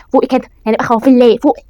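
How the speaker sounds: loud, urging wake-up call; rousing pep talk. Real, not acted. Player urging Fadia to stop being scared, rousing. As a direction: push them loudly to wake up.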